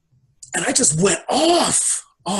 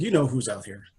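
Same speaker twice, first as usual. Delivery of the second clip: lower, softer, unhurried voice from beyond; calm voice of Source; 5 semitones lower, 7 dB quieter than usual. Retold or imitated. Source answering the man on stage, low and soft. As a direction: speak as a patient unseen presence.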